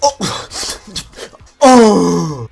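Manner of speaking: mock sulky teenage groans, the last one loud; Kevin from Kevin and Perry. Guy refused the weights answers the speaker, mock sulky groaning. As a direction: groan like an exaggerated huffy teenager. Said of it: "Looks like fucking Kevin from Kevin and Perry".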